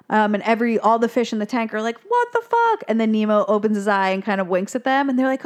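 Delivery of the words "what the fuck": very high-pitched, incredulous outcry; mock shock. Fish seeing Nemo apparently dead, high shocked outcry. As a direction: squeak it in acted disbelief.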